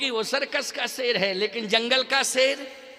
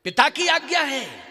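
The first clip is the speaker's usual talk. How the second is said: higher, louder acted exclamation; raised exclamation; 4 semitones higher, 4 dB louder than usual. Kaushalya's dialogue with Ram on father's order, raised exclamation. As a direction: call it out on a raised pitch.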